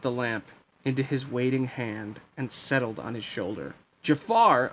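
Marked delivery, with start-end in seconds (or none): 4.0-4.7 mildly raised shout of a name. Acted. Aladdin spots Jafar with the lamp, shouting. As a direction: call out in recognition, a little loud. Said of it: "Aladdin shouted".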